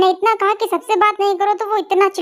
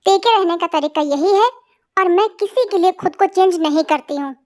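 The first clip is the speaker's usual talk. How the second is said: ordinary delivery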